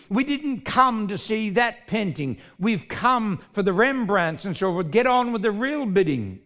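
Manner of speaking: loud, high angry yell; angry buyer shouting at the auctioneer. Acted. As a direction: demand they get on with it. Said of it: "another voice shouted angrily".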